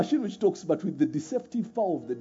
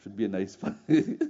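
lower, softer prim machine voice, a lightly voiced polite refusal; the chatbot politely refusing a vulgar user, lower and softer; 7 semitones lower, 7 dB quieter than usual.

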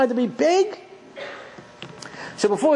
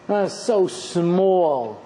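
sad lament; 5 semitones lower, much slower than usual. Acted. Lamenting father's smallness.

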